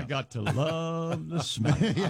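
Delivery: slow, stretched-out joke line with laughter; hammed-up catchphrase. Co-host milking the running Smeg joke, slowly hammed up. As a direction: draw out LOVE and SMEG for laughs.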